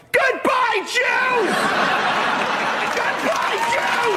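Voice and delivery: put-on extremely high shrill little-girl scream; child star yelling. Coached child actress gives casting the line, piercing yell. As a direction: shriek it with full showbiz gusto.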